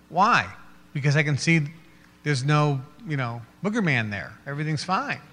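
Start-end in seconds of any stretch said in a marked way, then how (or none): none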